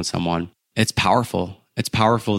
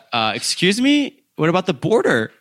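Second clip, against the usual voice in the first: incredulous disbelief; 9 semitones higher than usual. Retold or imitated. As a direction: sound like you can't believe your ears. Stunned traveler retorts to the driver.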